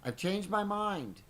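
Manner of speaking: high, loud, slow proclamation; loud announcement. A narrator's reading. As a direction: announce it grandly to the whole room. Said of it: "Jim announced loudly".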